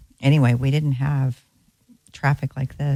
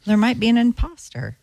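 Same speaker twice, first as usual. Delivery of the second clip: teasing, pitched up high; smart aleck; 9 semitones higher than usual. Real, not acted. Co-host teasing the host about an imposter, smart-aleck. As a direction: needle him playfully. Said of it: "That smart aleck voice that you just heard".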